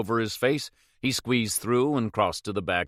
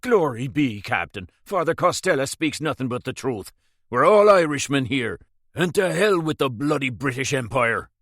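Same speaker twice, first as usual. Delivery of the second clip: excited publican, louder and higher; eager publican rallying, raised voice; lively Irish publican; 4 semitones higher, 5 dB louder than usual. A narrator's reading. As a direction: fire up as a proud Irish innkeeper.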